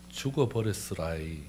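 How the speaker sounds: soft, falling Jeolla drawl; Jeolla dialect. Shown as a demonstration. Preacher demonstrating how Jeolla people say it, Jeolla accent. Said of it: "전라도 말은".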